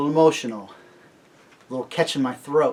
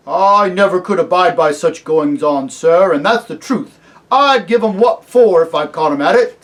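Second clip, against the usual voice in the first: loud, higher, rustic working man; country-voiced cabbie; 5 semitones higher, 12 dB louder than usual. A narrator's reading. Cabbie vowing to Aslan he'd punish bullies, country voice. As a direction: declare it with indignant honesty. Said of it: "his voice was growing slower and richer, more like the country voice".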